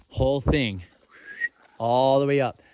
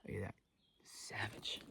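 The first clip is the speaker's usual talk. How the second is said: whispered; 3 semitones lower, 19 dB quieter than usual. Real, not acted. Marvelling at rotted tree carnage.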